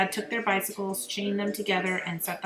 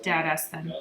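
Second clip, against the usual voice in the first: ordinary delivery, nothing marked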